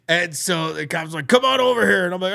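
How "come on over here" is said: high, loud imitation of a cop; calling over. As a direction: summon someone from far off. Cop calls him over.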